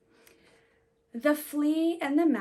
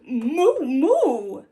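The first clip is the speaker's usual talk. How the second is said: cow moo; 2 semitones higher, 10 dB louder than usual. Acted. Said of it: "who muttered".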